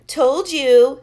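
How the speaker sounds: loud gloating snap; smug. A narrator's reading. Max gloating at Chloe, loud smug jab. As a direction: rub it in. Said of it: "said Max".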